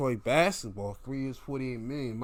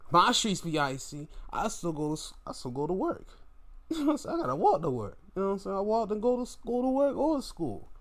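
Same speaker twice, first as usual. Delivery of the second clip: lightly mocking player, higher; mocking Ayton; 8 semitones higher, faster than usual. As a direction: poke fun at his excuse, pitched up. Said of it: "heard somebody say they called out".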